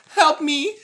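high, loud, frantic screaming for help; panicked swimmer voice. Pretend. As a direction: wail like a drowning swimmer. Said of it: "the way he's saying".

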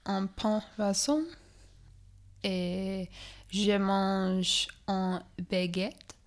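slow, careful put-on French accent; halting beginner's French, played up; mock French. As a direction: speak French haltingly for comic effect.

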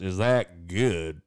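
put-on very slow, drawn-out Southern American accent; southern drawl. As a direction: stretch it out like a lazy Southern guy. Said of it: "got this, like, southern drawl".

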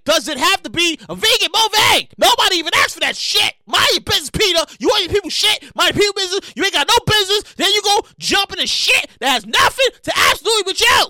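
high, loud scolding; angry rant. Real, not acted. Scolding PETA to mind their business, shouting. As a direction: tell them off.

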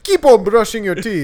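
high, loud cartoon crab, laughing. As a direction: cheer like an animated crab. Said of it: "the crab's voice".

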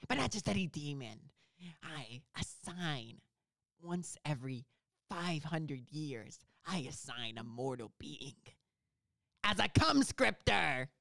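slow, portentous demon proclamation; hammed-up demon voice pronouncing a curse. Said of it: "am a demon".

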